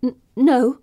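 stammered nervous no; stammering. Acted. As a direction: stumble over the no. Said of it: "I stammer".

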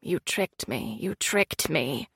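very low, fading echo; cave echo. A narrator's reading. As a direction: let it echo and fade away. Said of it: "her voice echoed off the cavern walls, repeating the words".

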